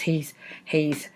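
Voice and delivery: usual speaking voice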